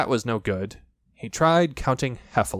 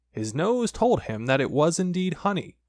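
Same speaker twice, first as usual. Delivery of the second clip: ordinary delivery, nothing marked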